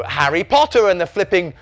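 high, slow, hammed-up title reading; teacher announcing a title. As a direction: build to a loud mock-dramatic finish. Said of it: "Or they'll go".

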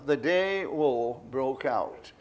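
very slow, measured comic drawl; deadpan it, stretching every word; Rob Wilson voice. Retold or imitated. Performer recalling his old comic impression, very slow deadpan. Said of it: "That was one of my impersonations".

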